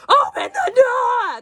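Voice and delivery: high, drawn-out panicked yell. Retold or imitated. Otto yelling at guards, panicked high shout. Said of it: "Otto is yelling to the top of his lungs".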